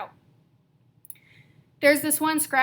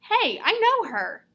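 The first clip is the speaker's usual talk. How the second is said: surprised inner thought; 8 semitones higher, 4 dB louder, slower than usual. Retold or imitated. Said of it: "I'm thinking".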